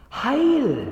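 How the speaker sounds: hohes, lautes Heil; freudiger Ausruf. Acted. Bote ruft Heil. (jubelnder Ruf)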